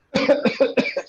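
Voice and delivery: loud upper airway cough; demo cough. Real, not acted. A volunteer shows the doctor a cold cough. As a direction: cough hard from the throat. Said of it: "he brought out a nice cough".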